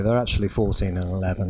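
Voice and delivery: ordinary talk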